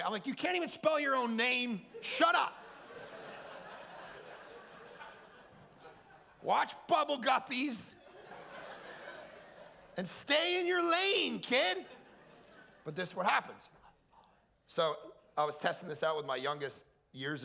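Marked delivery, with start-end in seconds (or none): 0.3-11.8 put-on play-yell at a toddler to stay in her lane; mock shout at kid.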